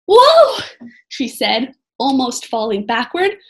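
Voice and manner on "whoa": loud, high yelp of surprise; nearly losing her footing; girl slipping on the steps, startled; startled whoa. Acted. Annie nearly falls backward.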